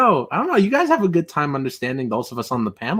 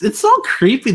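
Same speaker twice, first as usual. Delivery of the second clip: usual speaking voice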